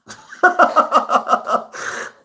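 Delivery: long airy high-pitched laugh; high breathy laugh. Real, not acted. Laughing at a viewer's kind comment, a high airy giggle.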